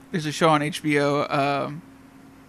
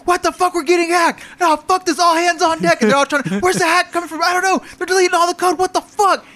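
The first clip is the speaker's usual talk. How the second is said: high, louder mock panic; hammed-up panic, much higher pitch; panicked coders; 12 semitones higher, 3 dB louder than usual. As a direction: squeal it like hacked programmers freaking out. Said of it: "And like".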